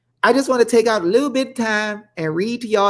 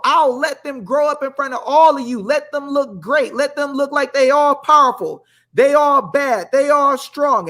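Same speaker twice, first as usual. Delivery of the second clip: preaching; 6 semitones higher than usual. Acted. Host preaching God's promise to viewers, fervent. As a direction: drive it home to the congregation.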